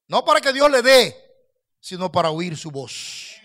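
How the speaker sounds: usual speaking voice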